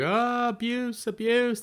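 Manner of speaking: high, drawn-out mock cry of abuse; mock yell. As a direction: wail it like kids hamming up a complaint. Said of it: "They like to yell".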